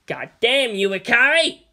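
put-on angry young man shouting. A narrator's reading. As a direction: yell it at him. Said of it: "shouted Kensuke".

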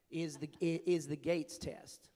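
put-on slow, drawn-out Southern accent; bad Southern accent. Delivering the governor's punchline in a slow mock Southern accent. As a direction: stretch "is the Gates test" slowly in a Southern accent. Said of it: "apologize for my bad Southern accent".

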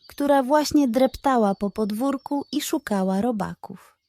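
plain reading voice

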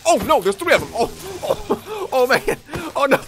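high, loud panicked cries with a laugh; real panic as creepers close in; panicked yelps. Real, not acted. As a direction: yelp at the ambush. Three creepers attack at once.

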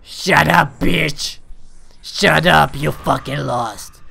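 taunting. Real, not acted. Taunting beaten enemy.

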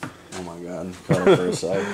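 fighting off hiccups mid-sentence; breaking up with hiccups. Real, not acted. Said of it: "I have the hiccups".

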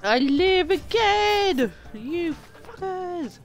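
vengeful proclamation. Pretend. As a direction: threaten them like a risen avenger. Lamb's vengeful declaration.

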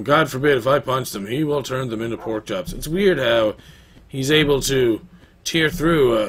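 ordinary delivery

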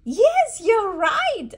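high, loud cheer; excited praise. Real, not acted. Mother cheering her toddler's right guess. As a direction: cheer the little one on.

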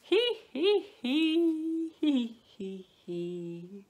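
high hee-hee, falling in steps; piggy giggle. A narrator's reading. Piggies safe inside laugh at wolves, giggling. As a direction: titter like mocking piglets. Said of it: "How the piggies laughed".

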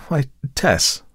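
a man trying to undo the scare he caused; soothing Tess. Acted. Narrator soothing sobbing Tessie.

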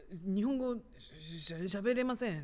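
mock foreigner stumbling over the words; halting beginner. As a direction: stammer through sudden halting Japanese. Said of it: "急に言うみたいな感じ". (急に片言になってつっかえる)

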